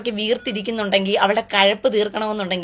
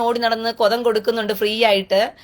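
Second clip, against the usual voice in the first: normal talking voice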